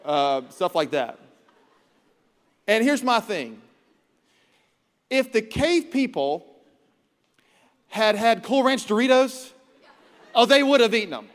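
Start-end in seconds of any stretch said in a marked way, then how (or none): 2.7-11.3 high, loud excited riff; excited joking. Real, not acted. Preacher joking to congregation, excited and loud. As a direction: get worked up about Doritos, laughing.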